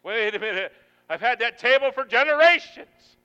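loud, high-pitched objection; protesting. Acted. Congregant objecting to giving up the old table, loud protest. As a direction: dig in your heels, raising the voice.

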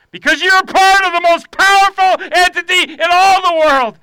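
very high, loud preacher's cry; shouted preaching. Acted. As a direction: shout it out to rally the congregation. Preaching at full force.

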